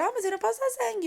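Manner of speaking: high, quick mother voice; playful imitation of her mom; quoting mother. Daughter quotes her mother.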